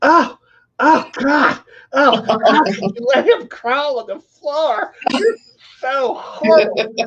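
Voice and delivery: high, shrill-free prissy gasp of disgust, slow at the end; mock disgusted lady. Lady in the doctor's office scolding a mom, high mock horror. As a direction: ham up a scandalized stranger, pitched high. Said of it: "looked at me and my mom like".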